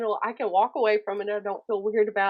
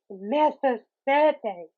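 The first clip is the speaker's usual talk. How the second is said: sassy correction; 3 semitones higher, much slower than usual. Retold or imitated. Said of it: "I'm like".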